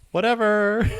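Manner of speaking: high, loud, airy brush-off; mock dismissive girl. The girl brushing off the kids, high bored dismissal. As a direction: toss it off like a bored teen. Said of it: "and she's all".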